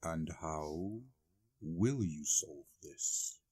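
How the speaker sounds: low, soft, very slow menace over steepled fingers; a hint of a cold villain, drawn out; menacing boss. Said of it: "he would say such things as".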